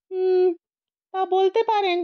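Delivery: a smug ghost grants a favour; lazy ghost. Acted. The lounging ghost lets the old man speak, smug and high.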